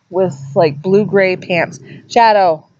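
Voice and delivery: usual speaking voice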